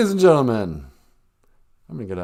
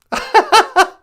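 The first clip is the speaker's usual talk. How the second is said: shrieking laugh, pitched way up; squealing cackle; high laughter; 9 dB louder than usual. Real, not acted. Laughing after own bit.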